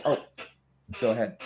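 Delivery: ordinary talk, nothing marked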